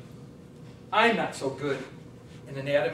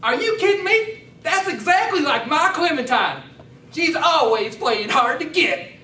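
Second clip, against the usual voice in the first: Cletus the country man; 8 semitones higher, 8 dB louder than usual. Acted. Farm visitor at the door, high loud yokel voice. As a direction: pitch way up and twang it out as the farm visitor. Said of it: "I'm Cletus".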